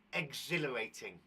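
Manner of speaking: ordinary delivery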